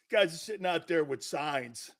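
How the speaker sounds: ordinary talk, nothing marked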